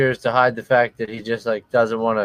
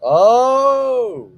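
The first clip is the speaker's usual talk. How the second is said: excited; 9 semitones higher, 3 dB louder than usual. Real, not acted. Reacting to a move.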